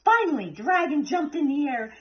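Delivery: ordinary delivery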